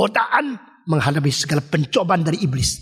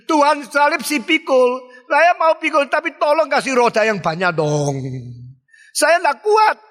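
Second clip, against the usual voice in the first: mock whining plea, higher and slow at first; whiny believer; 6 semitones higher than usual. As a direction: beg God for an easier cross, half-pleading. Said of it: "Banyak orang Kristen seperti ini". (orang Kristen merengek minta salib beroda)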